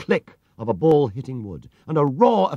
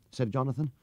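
ordinary delivery, nothing marked

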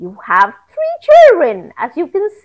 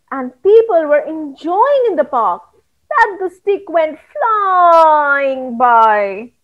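ordinary delivery

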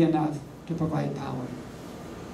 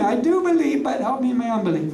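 exclaiming father; 5 semitones higher than usual. Retold or imitated. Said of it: "the boy's father exclaimed".

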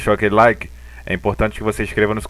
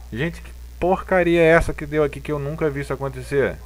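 high-pitched, disbelieving surprise; baffled; 6 semitones higher than usual. Real, not acted. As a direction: react like you can't believe it. Baffled by a strange chart.

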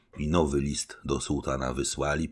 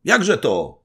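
much higher, louder cry; indignant exclamation; 13 semitones higher, 10 dB louder than usual. A narrator's reading. Stolnik confronts the general, loud indignant cry. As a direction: call out in disbelief. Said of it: "zawołał stolnik Latyczowski".

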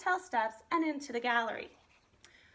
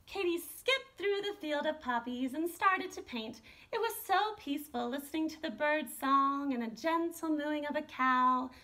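plain reading voice

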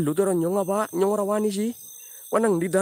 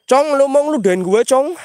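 loud, raised challenge to a ghost; confronting a ghost; 8 semitones higher, 10 dB louder than usual. Real, not acted. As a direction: call the pocong out, high and loud. Challenges a pocong for spitting. (menantang pocong dengan suara lantang)

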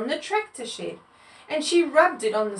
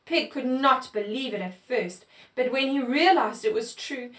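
ordinary delivery, nothing marked

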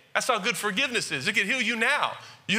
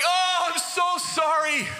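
mock groveling apology; 11 semitones higher than usual. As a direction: ham it up like a weeping Shakespeare penitent begging forgiveness. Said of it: "They're like".

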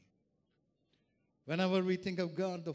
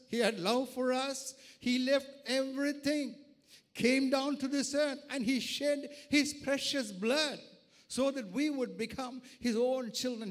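raised, driving sermon delivery; impassioned preaching; 6 semitones higher, 5 dB louder than usual. Real, not acted. Preacher to congregation on God's redemption, impassioned preaching. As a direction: proclaim it to the congregation with force.

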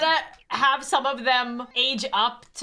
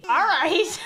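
usual speaking voice

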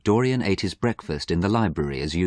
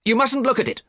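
high, urgent command; agitated raised-voice warning; shouting; 4 dB louder than usual. A narrator's reading. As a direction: forbid him in alarm. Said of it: "shouted Dorian".

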